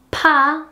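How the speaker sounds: pa with a strong burst of air; aspirated pa. Shown as a demonstration. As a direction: blow out pa hard. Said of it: "pa that has an aspirated consonant".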